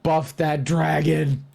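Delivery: high, loud, drawn-out battle cry; hyped shout. Pretend. Player cheering the party on to buff the dragon, hyped shout. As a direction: shout it slowly like a rallying call.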